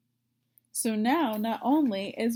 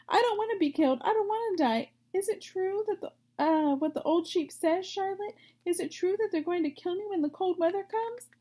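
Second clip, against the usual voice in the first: much higher, breathless panicked questions; a terrified little pig pleading with his friend; frantic piglet; 9 semitones higher, faster than usual. Acted. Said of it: "screamed Wilbur".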